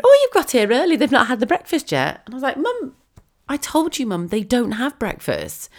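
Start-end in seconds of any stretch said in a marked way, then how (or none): 0.0-2.2 much higher, louder mum voice; fussy mum voice. Retold or imitated. Mum to daughter at the door, high fussy voice. 2.6-5.7 high, then slow exasperated scold; exasperated daughter. Retold or imitated. Daughter to mum about breakfast, high exasperated scolding.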